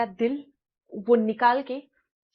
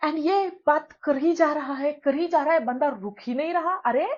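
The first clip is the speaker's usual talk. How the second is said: ordinary talk, nothing marked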